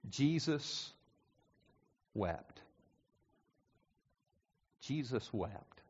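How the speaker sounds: soft, slow and solemn; hushed reverence. Acted. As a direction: let the verse fall quietly. Quoting briefest verse, hushed.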